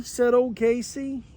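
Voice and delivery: ordinary delivery, nothing marked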